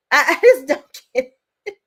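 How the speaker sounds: cracking up mid-sentence; can barely get the words out for laughing. Real, not acted. Laughing at the comeback.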